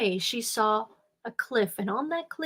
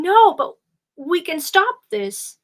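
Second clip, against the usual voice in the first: desperate plea; 7 semitones higher, 7 dB louder than usual. Acted. Girl pleads desperately.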